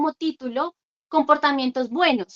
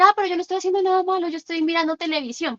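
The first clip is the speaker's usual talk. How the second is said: quick, higher-pitched excuse; lightly voiced protesting child; 4 semitones higher, faster than usual. Child defending himself to parent over television, mock protest. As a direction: imitate a child objecting in a hurry. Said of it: "Porque va a decir".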